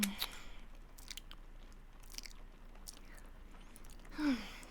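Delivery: long sigh. Acted. Vampire sated after feeding.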